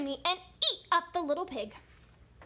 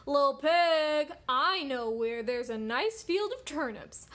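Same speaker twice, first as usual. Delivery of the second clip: wolf calling out; 2 semitones higher, 10 dB louder than usual. Acted. Wolf luring the little pig out to a field, calling out. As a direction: call coaxingly to someone indoors. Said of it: "the wolf came out and called out".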